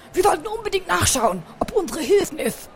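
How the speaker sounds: high, eager lisping hamster; Bertram, lisping. A narrator's reading. Hamster keen to rush to the rescue, eager lisp. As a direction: urge the others on in a high lisp. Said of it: "lispelte Bertram".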